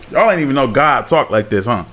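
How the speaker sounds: ordinary talk, nothing marked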